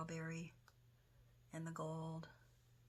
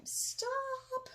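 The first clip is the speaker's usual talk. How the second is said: high-pitched, drawn-out yell; 4 dB louder than usual. Retold or imitated. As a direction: shout it at the television, stretching the word. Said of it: "I'm yelling at the T V all the time".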